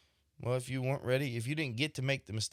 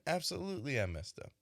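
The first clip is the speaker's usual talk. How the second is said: laughing reply; 3 semitones higher, 4 dB quieter than usual. Retold or imitated. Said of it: "And they just laugh".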